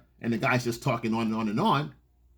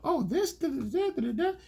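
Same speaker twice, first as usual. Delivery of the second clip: mock woman's chatter, much higher; 13 semitones higher than usual. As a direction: babble away like her, high and light. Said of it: "she goes into a com".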